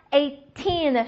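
put-on "eighteen" with a long, strong "teen"; stress on TEEN. Shown as a demonstration. Teacher drilling teen numbers, stress on the last syllable. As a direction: slowly, push the end of "eighteen". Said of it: "往后使劲儿啊".

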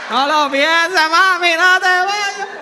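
loud desperate begging; pleading child. Retold or imitated. Child begging mother not to leave, loud pleading. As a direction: wail it like a kid clinging to mom.

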